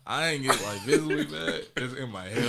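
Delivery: normal talking voice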